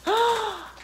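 high mock gasp of excitement; imitated gasp. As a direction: imitate a child's thrilled intake of breath. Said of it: "she goes".

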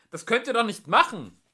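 high, loud protest; alarmed, full-voiced objection; crying out. A narrator's reading. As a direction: shout it, appalled. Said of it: "rief Trillian".

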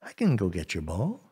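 a hint of a quiet, low frog; low-key frog making an offer; frog's offer. Frog offers help in story.

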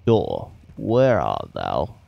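slow, low theatrical summons; mock-archaic calling. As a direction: call for it like a hammy old play, slow and low. Mock-archaic call to a door.

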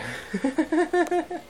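high giggle; high laugh. Real, not acted. Vlogger giggling about his dog, high.

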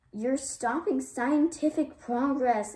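loud, high protest yell. A narrator's reading. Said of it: "Ivy yelled".

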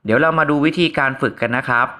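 normal talking voice

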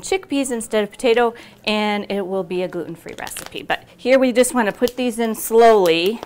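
usual speaking voice

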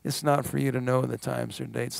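a gentle teacher's correction, kind and soft; kindly. Retold or imitated. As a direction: answer them kindly and calmly. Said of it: "he looks at them kindly and he says".

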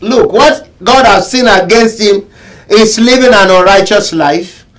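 ordinary delivery

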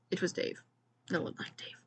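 hushed whisper about Dave; whispered aside. A narrator's reading. Narrator drops to whisper aside.

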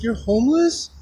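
high questioning rise; imitating surprised woman. Woman learns the man helping her is homeless, imitated surprise.

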